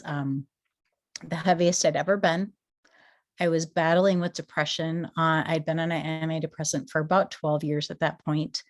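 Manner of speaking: ordinary delivery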